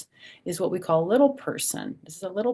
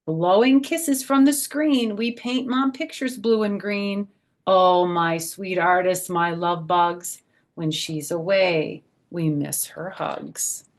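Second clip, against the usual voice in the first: bouncy rhyming picture-book voice; reading to children; 2 semitones higher, 8 dB louder, slower than usual. Acted. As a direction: read the rhyme aloud to a group of little kids. Reads rhyming picture book aloud.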